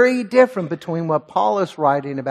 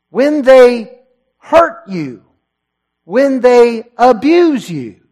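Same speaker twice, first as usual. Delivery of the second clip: preaching climax; 6 semitones higher, 6 dB louder than usual. Acted. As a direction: drive it home to the congregation.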